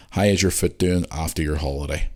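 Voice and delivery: normal talking voice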